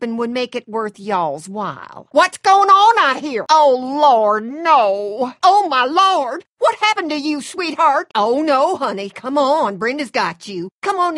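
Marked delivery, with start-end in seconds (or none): 2.1-8.8 loud, high cries of dismay; alarmed concern. Acted. Calling to an injured sweetheart, alarmed. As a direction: cry out in shock at someone's injury. Finds someone hurt outside.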